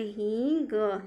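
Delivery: हींग with a long ee and clear ending; drawn-out हींग. Shown as a demonstration. Teacher flags a key word for students, drawn-out हींग. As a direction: stretch हींग slowly. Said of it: "most important है".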